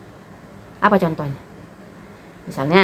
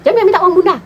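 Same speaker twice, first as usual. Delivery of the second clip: higher, louder mock scold at the kids; imitating an angry aunt, raised and sharp; angry sister snapping; 11 semitones higher, 4 dB louder than usual. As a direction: sister flaring up at begging children. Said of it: "Wah, marah dia tuh".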